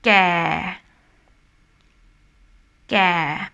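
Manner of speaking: "Gè" drawn out low. Shown as a demonstration. Teacher giving the word for old, low tone "Gè". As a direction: let "Gè" sink and stay down. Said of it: "low tone".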